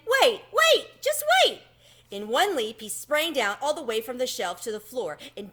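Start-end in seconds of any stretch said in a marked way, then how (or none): 0.1-1.6 high excited mouse voice, much louder; excited squeak. A narrator's reading. Tucker breaks in on Chester, squealing excitedly. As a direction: leap up with a squealing wait wait. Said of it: "Tucker broke in".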